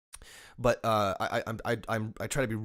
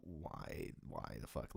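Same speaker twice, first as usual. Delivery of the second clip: muttered frustration; 12 semitones lower, 17 dB quieter, much slower than usual. Retold or imitated. Voicing frustrated customer.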